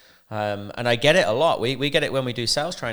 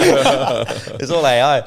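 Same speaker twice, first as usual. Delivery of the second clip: laughing; 4 semitones higher, 8 dB louder, much slower than usual. Real, not acted. Laughing at own slip on air.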